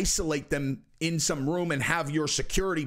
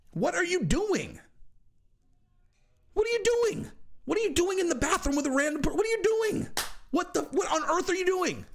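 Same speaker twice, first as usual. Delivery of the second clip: much higher and building to a shout; exasperated shouting; 13 semitones higher than usual. Real, not acted. Vlogger yelling at Connor over the bathroom, exasperated. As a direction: yell it in utter disbelief.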